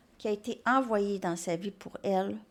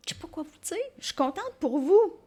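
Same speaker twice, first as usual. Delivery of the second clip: gushing delight; 7 semitones higher, 4 dB louder, faster than usual. Real, not acted. Repeated line, gushing second take.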